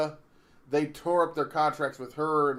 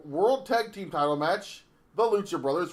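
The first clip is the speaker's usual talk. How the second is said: ordinary delivery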